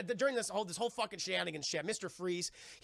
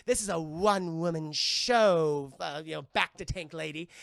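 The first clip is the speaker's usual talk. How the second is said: villainess voice; 3 semitones lower, 7 dB louder than usual. Retold or imitated. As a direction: purr it out like a gloating villain. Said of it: "She goes".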